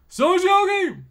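high, loud, delighted call; excited shout. Real, not acted. Spotting a good pull on stream, an excited high shout. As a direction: yell it out, thrilled.

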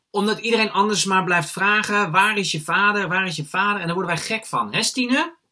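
high, loud, worked-up rant; exasperated outburst. Acted. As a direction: burst out, mimicking nagging questions. Exasperated outburst about nagging questions.

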